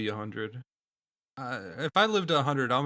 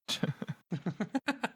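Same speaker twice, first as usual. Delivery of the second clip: high laugh; 7 semitones higher, 7 dB quieter than usual. Real, not acted. Laugh after embarrassment.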